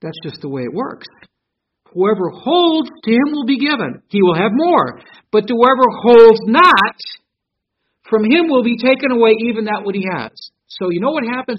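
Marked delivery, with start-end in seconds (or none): 1.9-10.3 loud, every phrase stressed and falling; emphatic scripture reading. Shown as a demonstration. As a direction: proclaim the verse with weight. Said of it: "reads verse emphatically".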